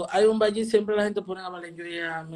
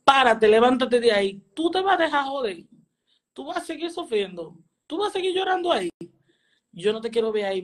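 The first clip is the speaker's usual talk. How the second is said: imitated angry father, higher pitch; retold fatherly telling-off, pitched up; [name]; 3 semitones higher than usual. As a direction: scold like a fed-up dad, opening hard. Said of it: "Cuando un papá le habla a sus hijos que está quillado".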